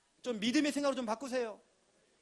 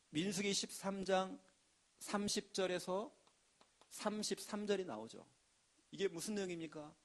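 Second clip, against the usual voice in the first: ordinary talk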